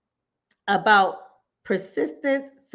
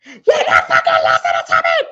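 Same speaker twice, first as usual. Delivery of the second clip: loud, angry yell down the phone; furious shout; 2 semitones higher, 10 dB louder than usual. Retold or imitated. Upset caller yelling at the counselor, loud angry shout. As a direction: blast it at the listener. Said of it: "Click, call back".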